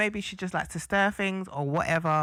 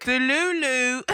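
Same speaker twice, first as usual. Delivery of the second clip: slow, drawn-out teasing delulu with a laugh; 2 semitones higher, 4 dB louder, slower than usual. Real, not acted. Co-host calling Harriet delusional, drawn-out tease. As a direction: stretch it out mockingly.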